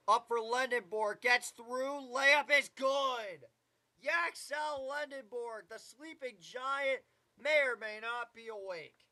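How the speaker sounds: brisk, rising basketball call; live play-by-play. Acted. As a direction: call the game as it unfolds.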